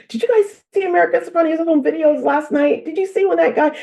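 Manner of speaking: high-pitched eager questions; imitating excited chatter at work; re-enacted excited coworker. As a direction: pitch it way up, bursting to share. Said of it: "I would go to work and say".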